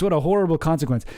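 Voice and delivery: ordinary talk, nothing marked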